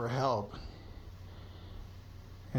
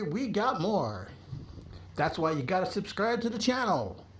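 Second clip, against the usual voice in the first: upbeat channel outro; 7 semitones higher than usual. Acted. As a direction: hype the subscribe button.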